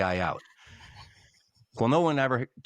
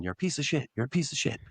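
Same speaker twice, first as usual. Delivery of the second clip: high-pitched mock yell of insults; imitating an angry player yelling from the box; retold yelling; 6 semitones higher, faster than usual. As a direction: repeat the insult like a jeering chant. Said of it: "just keeps yelling".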